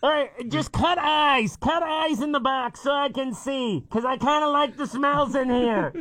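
high, muffled-sounding office lady; office lady stuck in box. Pretend. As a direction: play a trapped, flustered office worker. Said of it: "office ladies walking around the office".

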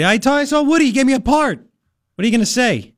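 excited Kramer. Retold or imitated. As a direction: burst with news like Kramer. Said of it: "And Kramer said".

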